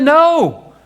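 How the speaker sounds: high and loud, booming a firm no; God speaking to Balaam, loud and forbidding; God's stern command. Acted. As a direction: thunder down the refusal as God.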